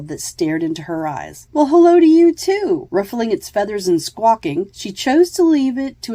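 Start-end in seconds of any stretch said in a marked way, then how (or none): 1.5-2.9 high, loud cooing to a bird. Acted. Kira coos back at the macaw, high and loud. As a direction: coo back at the parrot, pitched way up. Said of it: "Kira cooed".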